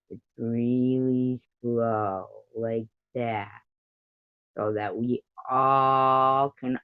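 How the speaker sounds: long drawn-out words, lots of pauses; slow it right down so all can follow; Lemon, really slow. Shown as a demonstration. Streamer perfecting an imitation of a chat friend, really slow. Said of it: "Gotta perfect my Lemon voice".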